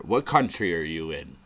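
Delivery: ordinary talk, nothing marked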